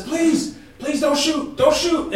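high, loud panicked begging; man in the basement begging the soldiers, panicked; frightened pleading. Acted. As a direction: plead for mercy, terrified. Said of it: "No, he says".

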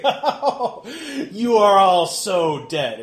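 loud, slow, high gloating threat; laughing menace. Acted. Froman laughs at the armed servants, laughing menace. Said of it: "Froman kind of laughs".

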